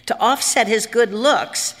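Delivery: usual speaking voice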